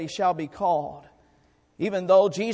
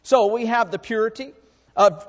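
ordinary talk, nothing marked

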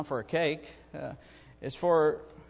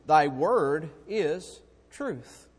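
1 very slow solemn reading; much slower than usual. Real, not acted.

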